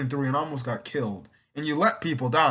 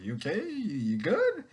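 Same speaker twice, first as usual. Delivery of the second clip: mock concern; 5 semitones higher than usual. Mock checking on awkward Courtney.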